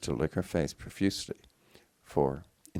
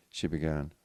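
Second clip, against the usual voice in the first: ordinary delivery, nothing marked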